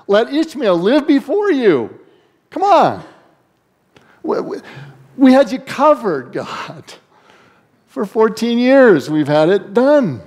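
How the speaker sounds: high-pitched pleading disbelief, Abraham arguing with God; incredulous Abraham. Acted. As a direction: play Abraham, aghast that God ignores Ishmael.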